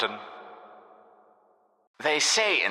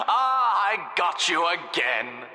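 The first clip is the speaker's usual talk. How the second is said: gloating; 10 semitones higher, slower than usual. Acted. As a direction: crow over the victory. Gloating after destroying player.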